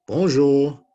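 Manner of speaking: big, loud puppet voice; Matisse puppet voice. Acted. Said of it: "Matisse and Inez".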